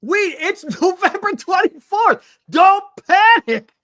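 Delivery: loud, high, drawn-out protest; slow down and holler at a panicking co-host; exasperated plea. Real, not acted. Heated plea not to panic.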